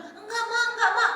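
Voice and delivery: high-pitched mock denial; child fibbing to mother. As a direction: play a child protesting innocence to mum. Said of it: "Itu kamu sudah bohong".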